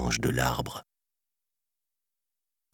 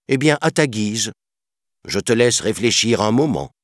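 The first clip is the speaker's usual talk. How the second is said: higher, fed-up panther voice; exasperated; 6 semitones higher than usual. A narrator's reading. Panther leaving the stubborn boy, exasperated. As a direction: give up on arguing with a stubborn child. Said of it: "excédée".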